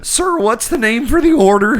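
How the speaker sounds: put-on a teen doing Shaggy from Scooby-Doo. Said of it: "That's the best Shaggy from Scooby-Doo".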